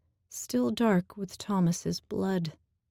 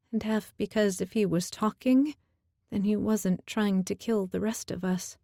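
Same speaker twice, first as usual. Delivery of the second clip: ordinary delivery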